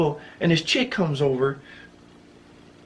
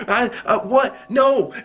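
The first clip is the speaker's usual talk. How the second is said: higher, stammering disbelief through a laugh; facing a threat, higher laughing disbelief; flustered disbelief; 7 semitones higher, much slower than usual. Retold or imitated. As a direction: sputter it, caught off guard. Threatened with last words.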